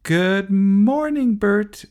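high, loud, drawn-out call; shouted greeting. Acted. Girl calling to neighbor over the garden, loud shout. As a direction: call across the garden to him. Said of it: "shouted Sophie".

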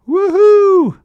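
very high, loud cheer; shouting. A narrator's reading. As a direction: whoop it out in triumph. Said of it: "shouted the engines".

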